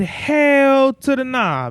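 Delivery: flat refusal, dragged out slowly; drawn-out refusal. Retold or imitated. Telling the dealership hell no about the recall, drawn out, loud and high. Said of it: "I said".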